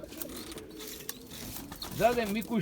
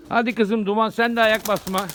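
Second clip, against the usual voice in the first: louder call to the pigeon; 3 semitones higher, 7 dB louder than usual. Real, not acted. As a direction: urge the bird on, raised voice. Calling out to his pigeon. (güvercinine seslenen adam, yüksek sesle çağırıyor)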